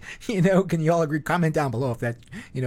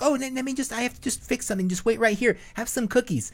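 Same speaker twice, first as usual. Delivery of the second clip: put-on much higher sweet girlish voice; playful mock girl, pitched up; young girl decoy; 12 semitones higher than usual. Decoy girl at the door, high mock girlish. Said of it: "she'd be like".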